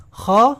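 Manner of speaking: like about to spit: خا; rasped kha. Shown as a demonstration. Teacher demoing the throaty kha. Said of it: "ہلکا سا ایک sound آتا ہے".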